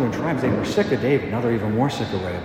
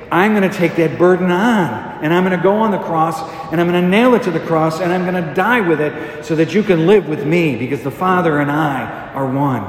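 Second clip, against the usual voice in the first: raised, driving promise, settling on the last phrase; impassioned preaching; 4 semitones higher, 4 dB louder than usual. Acted. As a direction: preach the vow with force, phrase by phrase.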